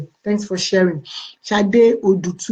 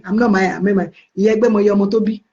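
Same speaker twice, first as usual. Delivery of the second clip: ordinary talk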